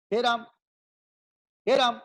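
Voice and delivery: हे राम with quick short vowels. Shown as a demonstration. Teacher showing the short vowel, quick and light. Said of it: "तो ये हो गया ह्रस्व".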